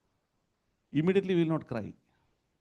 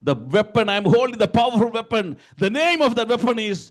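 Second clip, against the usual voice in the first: high, loud, emphatic declaration; full-force preaching; 11 semitones higher, 8 dB louder than usual. Acted. Preacher naming the powerful weapon, forceful preaching. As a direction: proclaim it phrase by phrase to a crowd.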